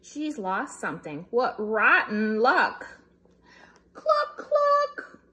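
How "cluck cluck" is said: high hen clucks; high squeaky clucks; hen clucking. Acted.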